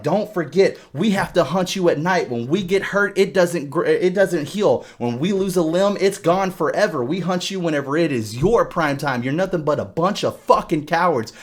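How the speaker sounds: high-pitched, indignant retold speech; impassioned hero tirade. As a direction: act out a young hero railing at his enemy. Acted defiant speech at fleeing demon.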